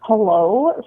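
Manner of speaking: ordinary talk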